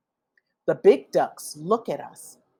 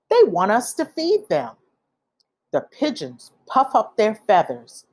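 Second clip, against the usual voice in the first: plain reading voice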